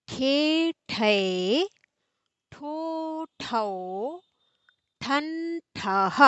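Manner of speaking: slow, sing-song syllable chant; barakhadi recitation. Shown as a demonstration. Hindi teacher reciting the barakhadi to students, sing-song chant. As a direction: chant each syllable like a class drill.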